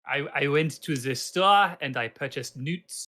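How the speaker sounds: lightly put-on Italian NPC voice; slow, hammy Italian shopper; Italian accent. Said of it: "an Italian N P C now who just says".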